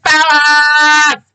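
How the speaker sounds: high, loud, slow diva shout; hammed-up Faye Dunaway as Crawford; Joan Crawford boardroom. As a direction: bark it like a screen queen. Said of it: "a la Faye Dunaway as Joan Crawford in the boardroom".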